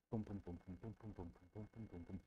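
low, very soft, steady booms; imitated heartbeat. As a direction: thump softly like a beating heart.

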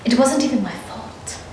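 ordinary talk

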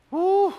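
sudden high woo; high whoop. Real, not acted. Preacher whooping at his own point.

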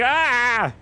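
high, strong cry of exasperation; frustrated yell. Real, not acted. Streamer fed up with frame rate, frustrated yell. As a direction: let out a frustrated scream.

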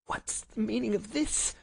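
high, dry villain's rasp. A narrator's reading. Stunned villain confronting his victim, dry and rasping. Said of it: "in a dry, rasping tone".

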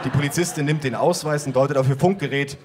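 usual speaking voice

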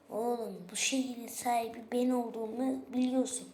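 normal talking voice

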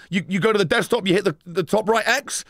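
usual speaking voice

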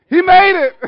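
loud, high-pitched triumphant cry; jubilant shout. Real, not acted. Pastor joking about passing school, jubilant shout. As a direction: shout it out in celebration.

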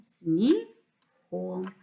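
"сміхом" as two pieces, смі, хом; split into syllables. Shown as a demonstration. Teacher dividing a word for pupils, "сміхом" syllable by syllable. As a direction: pause in the middle of "сміхом", after смі. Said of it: "слова на склади".

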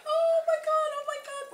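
excited squeal. Retold or imitated. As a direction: squeal it like you just opened a gift. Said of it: "I was just like".

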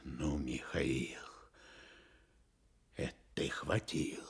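breathy hushed whisper; a low whispered reproach. Acted. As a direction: rebuke him under your breath. Reproaching Mikhail quietly.

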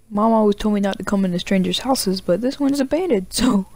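ordinary talk, nothing marked

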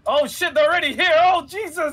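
high panicked yelling, real alarm; panicked shout. Real, not acted. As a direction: shriek it in sudden gaming panic. Enemies suddenly at their base.

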